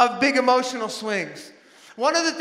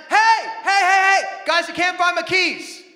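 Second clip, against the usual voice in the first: put-on high, loud panicked calls to the kids; frantic shout; 8 semitones higher, 5 dB louder than usual. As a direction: yell for them like a dad who lost his keys. Frantic dad calling to family.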